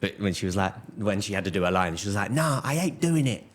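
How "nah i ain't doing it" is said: high-pitched mock child digging in; defiant small-child voice; stubborn little kid. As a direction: imitate a four-year-old refusing point-blank. Imitating a child refusing her line.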